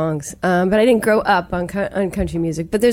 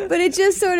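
laughing; 11 semitones higher than usual. Real, not acted. Laughing after teasing.

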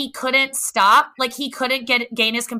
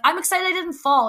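defensive; 7 semitones higher than usual. Retold or imitated. Defending herself to judges.

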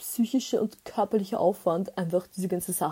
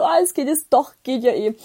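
mock turnaround; 3 semitones higher, 6 dB louder than usual. Imitating his sudden turnaround.

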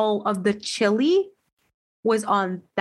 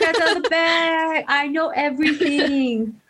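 higher, slow mock-smug boast; smug gloating voice; 6 semitones higher than usual. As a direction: gloat like you know every secret. Mock-triumphant echo of own quote.

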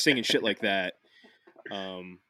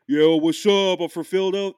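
loud, higher-pitched Philly greeting; Philly accent; 8 semitones higher, 8 dB louder than usual. Acted. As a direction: shout it like a Philadelphia local. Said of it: "I actually did the Philly accent there".

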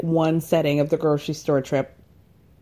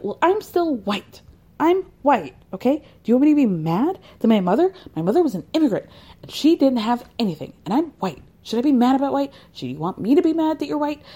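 mock indignant Ramona; 6 semitones higher, faster than usual. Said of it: "Ramona's like".

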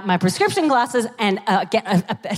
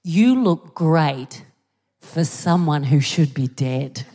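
backhanded compliment; 6 semitones lower, slower than usual. Retold or imitated. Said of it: "what you mean is".

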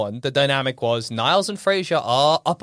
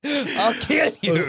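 mock threat; 4 semitones higher, much slower than usual.

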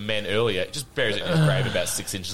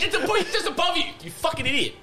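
exasperated. Real, not acted. Exasperated laugh at idiot.

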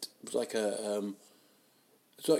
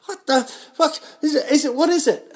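shocked outburst; 13 dB louder, faster than usual. Retold or imitated. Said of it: "I was like".

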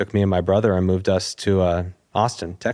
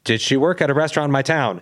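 higher, at normal pace without halting; pressing question; 5 semitones higher, faster than usual. Retold or imitated. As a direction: repeat the question as if needing an answer now. Repeats the question, pressing for answer.

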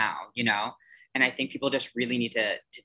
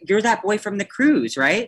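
pitched up, louder sudden realization; surprised recognition; 9 semitones higher, 6 dB louder than usual. Retold or imitated. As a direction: retell the moment it clicked, a bit louder and higher. Recognizing the boy at camp.

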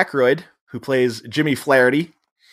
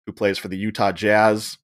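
ordinary talk

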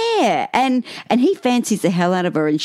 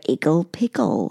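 put-on old English narrator; 3 semitones lower than usual. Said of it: "And the old English narrator".

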